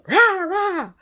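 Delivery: high, blaring rah-rah; mock your own loud voice; comic high-pitched blare; loud self-mockery. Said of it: "And here I am".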